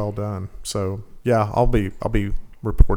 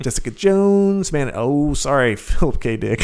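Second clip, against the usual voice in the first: mock announcer voice; 4 semitones higher than usual. Mock sign-off voicing himself.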